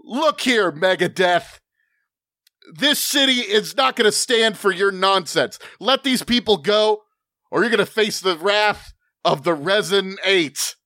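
high, theatrical hero challenge; grandstanding superhero. Acted. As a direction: declare it to the villain like a showy hero.

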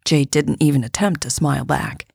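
usual speaking voice

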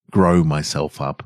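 ordinary delivery, nothing marked

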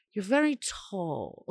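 quiet, slow, starstruck; a light impression of a dazzled fan, soft and slow; awed sister. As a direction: murmur it up at someone tiny, lightly voiced. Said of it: "I could so hear her saying it".

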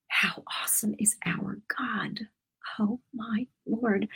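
hushed, breathless wonder; awed whisper. Real, not acted. Marvelling at God's goodness to her viewers, whispered. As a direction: whisper it like you can't believe it.

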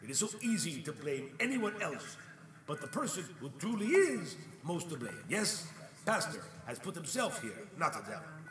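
usual speaking voice

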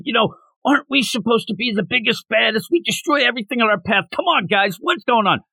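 imitating a fired-up team-mate. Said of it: "He starts getting fired up about monsters".

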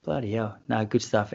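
normal talking voice

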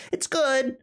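high, put-on shrug of an "it's good"; lukewarm self-quote. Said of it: "personally was just like".

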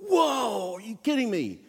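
high-pitched imitation of astonished kids; mock amazement. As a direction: play a classroom abuzz with disbelief. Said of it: "the class starts just as a buzz".

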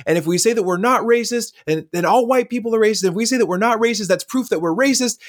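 mock angry uncle rant. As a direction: rattle it off like a riled-up uncle. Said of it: "he was still angry".

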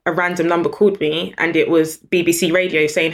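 ordinary talk

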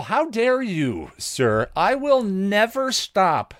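high, hammed-up indignation; theatrical huff at the viewer; mock outrage. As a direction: play the offended gentleman, then drag out the vow slowly.

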